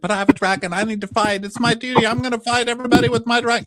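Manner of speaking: put-on squeaky kid begging to fight; mock eager hero. Darren voiced insisting on fighting.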